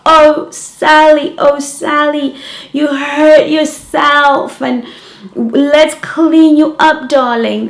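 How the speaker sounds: high, slow, cooing teacher voice; comforting teachers. Retold or imitated. Teachers soothing Sally after she scrapes her knee, cooing high and slow. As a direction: coo over a hurt child like a kind teacher.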